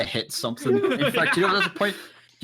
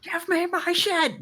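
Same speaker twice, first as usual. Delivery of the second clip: shouting; slower than usual. Acted. Player voicing character's yell.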